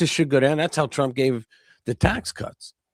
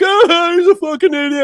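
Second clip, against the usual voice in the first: high, loud mocking whine of an outraged liberal; hammed-up shocked liberal squeal; mocking liberal outrage; 6 dB louder than usual. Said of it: "You're like".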